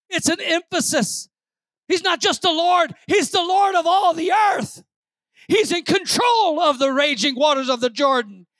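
high, emphatic preacher's delivery; forceful preaching. Real, not acted. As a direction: press the point on the congregation.

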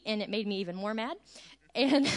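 ordinary talk

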